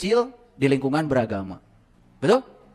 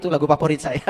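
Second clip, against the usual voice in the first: usual speaking voice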